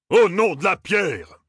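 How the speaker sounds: slow, high-pitched bellow; bawling. A narrator's reading. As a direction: bawl an outraged oath. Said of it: "brailla-t-il enfin". (en braillant)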